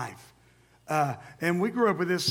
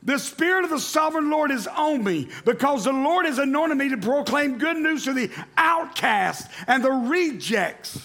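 raised, ringing proclamation, slowing on the rejects; higher ringing scripture read; 8 semitones higher, 3 dB louder than usual. Acted. Pastor reading the reworded verse to church, ringing. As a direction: proclaim outcast and rejects with weight. Reads new translation.

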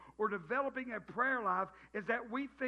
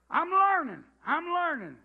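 rueful exclamation; 5 semitones higher, 9 dB louder than usual. Real, not acted. Self-mocking admission.

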